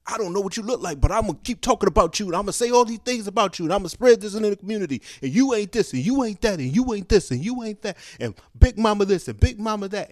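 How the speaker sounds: high, fast, rattling accusations; mock gossiping gatekeeper. Gatekeeper spreading rumors about someone, high and rapid mocking voice.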